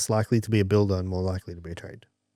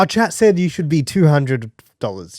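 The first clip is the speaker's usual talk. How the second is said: indignant customer; 5 semitones higher, 4 dB louder than usual. Retold or imitated. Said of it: "And he's like".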